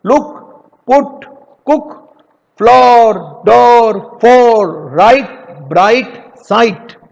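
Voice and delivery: words called out loud for the class to echo; loud repeat drill. Shown as a demonstration. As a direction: lead the class through the list again loudly. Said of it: "एक बार और बोलो". (बच्चों को दोहराने के लिए ज़ोर से)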